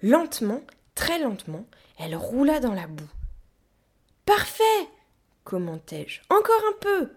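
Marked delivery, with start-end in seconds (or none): 4.2-4.6 high, loud cheer; encouraging shout. A narrator's reading. Child at the wheel cheers on the gorilla, shouting. 6.3-7.1 high, loud call; urging shout. A narrator's reading. Child urges the gorilla to keep pushing, shouting.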